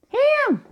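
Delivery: "ham," popped up very high; her high ham. Retold or imitated. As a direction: squeak "ham," like grandma. Her funny ham said again.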